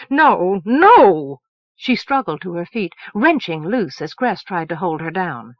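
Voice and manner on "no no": loud shouted no; shouted denial. Acted. Moon to Elsevier and Aspunt: loud shouted denial. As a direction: shout the truth away.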